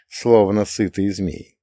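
ordinary delivery

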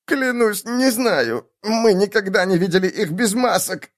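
high, pained pleading; frightened plea. A narrator's reading. Captive answering the dwarf after a blow, high plea. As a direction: swear it in a high, desperate voice.

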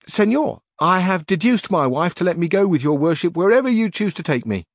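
earnest bumbling squire; Sancho Panza. A narrator's reading. Sancho tells his master his wife agreed, loud and eager.

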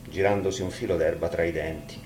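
ordinary delivery, nothing marked

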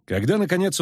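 ordinary delivery

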